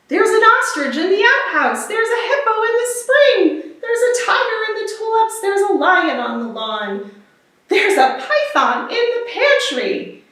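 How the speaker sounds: mock astonished villagers; excited townsfolk. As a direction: list the animals in rising alarm. Townsfolk spot animals everywhere.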